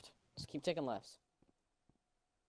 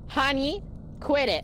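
mom voice; 8 semitones higher than usual. Pretend. Voicing mom in roleplay.

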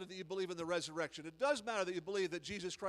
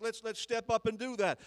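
normal talking voice

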